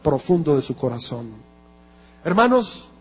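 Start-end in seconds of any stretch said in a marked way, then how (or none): 2.2-2.9 "Hermanos" called out higher and a bit louder, rising to grab attention; calling hermanos. Real, not acted. Preacher calling out to the congregation to wake them, raised.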